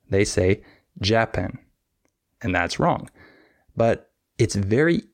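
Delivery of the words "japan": Japan mis-stressed as JA-pan, weak second syllable; stress on first syllable. Shown as a demonstration. Teacher imitating English learners' mistake, Japan stressed on first syllable. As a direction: lean on the first half of Japan. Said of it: "stress the wrong syllable".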